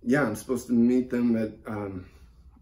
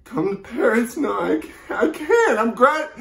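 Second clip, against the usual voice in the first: high, faltering distress, getting louder; panicked refusal; 8 semitones higher, 5 dB louder than usual. Acted. Caller asked to come to Paris, panicked refusal.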